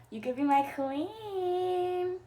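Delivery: hammed-up wistful longing. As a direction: sigh it out slowly like a lovesick villain. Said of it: "Looks wistfully".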